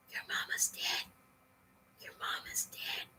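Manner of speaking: soft whisper of a little voice in the head; tiny inner voice. Retold or imitated. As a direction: whisper it like a nagging thought at the back of the mind. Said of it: "little tiny voice".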